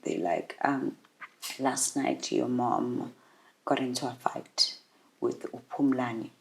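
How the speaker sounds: very soft, low retelling; quiet and low, with heavy pauses; breaking bad news. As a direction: break it gently, in a hushed low voice.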